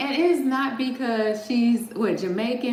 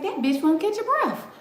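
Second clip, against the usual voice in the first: high squeaky mock complaint, much higher; mock exasperated aside; 10 semitones higher than usual. Telling viewers about the nonstop talker, high mock gripe. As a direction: squeal it like you can't take another word. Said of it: "I was like".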